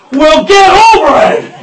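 barked, high and loud; drill sergeant. Acted. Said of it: "the drill sergeant then just starts".

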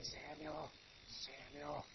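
the Lord calling quietly in the dark; soft calling. Acted. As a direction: call the name softly twice.

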